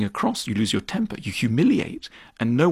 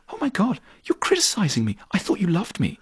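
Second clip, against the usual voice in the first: mock hurt; 8 semitones higher than usual. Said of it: "the partner turns around and goes".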